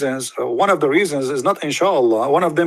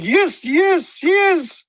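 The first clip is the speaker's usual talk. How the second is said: squeaky, much higher yes; mock excited; much slower than usual. The follower answering the Prophet, squealing with mock excitement. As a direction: bounce out the yeses like an overeager kid. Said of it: "they got so excited".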